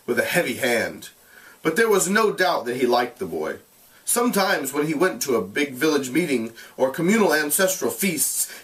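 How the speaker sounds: measured, expressive read-aloud; storyteller reading a classic novel aloud; story narration. A narrator's reading. Teacher reads novel aloud to class.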